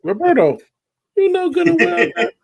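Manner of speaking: high, slow mock scammer voice; imitating scammer. Mimicking the romance scammer on the video. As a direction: put on the scammer's pleading voice. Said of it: "he sound like".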